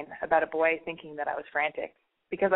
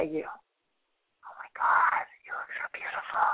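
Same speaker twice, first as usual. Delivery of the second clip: mock-creepy whispered sweet talk; intimate whisper; slower than usual. Host to listener in mock love, intimate whisper. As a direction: whisper slowly, leaving long pauses, as if to a crush.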